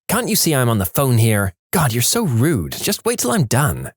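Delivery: understated imitation of an irritated, rude customer; mild mock snapping. A narrator's reading. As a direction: snap lightly at someone interrupting your call. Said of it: "And the girl snapped".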